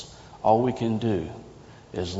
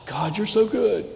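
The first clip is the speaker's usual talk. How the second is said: sigh, then high-pitched delighted praise; grateful gushing; 10 semitones higher than usual. Acted. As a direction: gush to God after a blissful sigh. Acting out a glad prayer.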